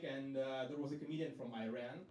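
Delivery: ordinary talk, nothing marked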